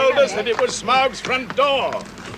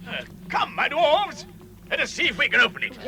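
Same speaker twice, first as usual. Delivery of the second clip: normal talking voice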